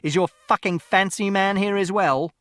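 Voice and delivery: father shouting at mother about her lover, loud; jealous shout. Acted. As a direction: hurl the accusation at her. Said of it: "And Father shouted".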